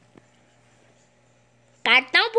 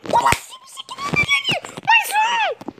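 much higher, incredulous squeal; high astonished cry; 14 semitones higher than usual. Real, not acted. Gamer amazed at his striker scoring again, high incredulous cry. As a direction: squeal in disbelief at the scoreline. Reacting in game.

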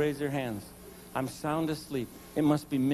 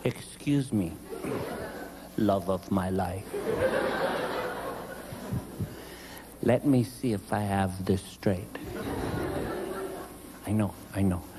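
mock patience; 7 semitones lower, 5 dB quieter than usual. Husband answering his wife, lower, mock-patient and dry. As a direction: humour her with low exaggerated patience.

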